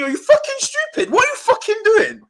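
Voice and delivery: high-pitched, incredulous yell at the player; exasperated outburst. Real, not acted. Fan yelling at Pogba over a penalty, exasperated high outburst. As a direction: shriek it in total disbelief.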